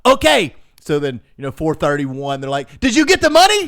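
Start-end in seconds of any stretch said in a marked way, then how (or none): 0.0-0.5 loud, high exasperated reply; exasperated acceptance. Retold or imitated. Scammer told to wait until four thirty, exasperated. 2.8-3.7 high, loud mock demand; demanding yell. Scammer checking right at the deadline, demanding yell.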